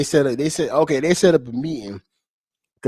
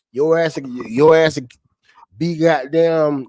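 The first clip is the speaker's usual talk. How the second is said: normal talking voice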